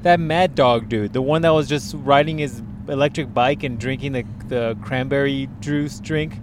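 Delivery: ordinary talk, nothing marked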